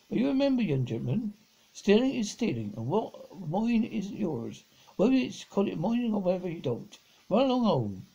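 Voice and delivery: lightly acted lecture, pressing the key words; mild admonishment. A narrator's reading. Station master warns the young gentleman about stealing, mild admonishment.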